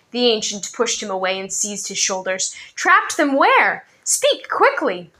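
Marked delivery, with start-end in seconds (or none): 2.7-5.1 urgent demand. A narrator's reading. Old man urgently demanding.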